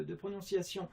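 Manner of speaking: ordinary delivery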